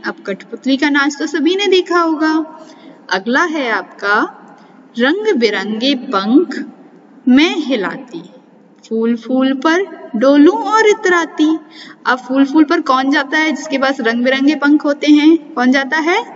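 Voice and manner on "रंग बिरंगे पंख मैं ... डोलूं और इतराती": put-on sing-song riddle recitation for children; reciting a riddle. Shown as a demonstration. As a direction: lilt the rhyme like a playful puzzle. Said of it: "अब हम कुछ पहेलियाँ पढ़ेंगे". (बच्चों को पहेली लय में सुनाना)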